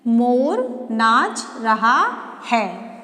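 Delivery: whole sentence read slowly; slow full reading. Shown as a demonstration. Teacher reading the finished sentence to pupils, slowly. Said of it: "देखिए, एक वाक्य बन गया।".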